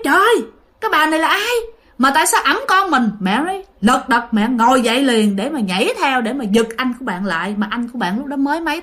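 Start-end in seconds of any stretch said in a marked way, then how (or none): 0.0-3.1 higher-pitched startled questioning; alarmed exclamation. Acted. Mother seeing a stranger carry her baby, alarmed.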